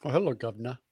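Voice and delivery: put-on English accent. Asked to indulge in an English accent, soft mock English accent. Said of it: "would you like to indulge".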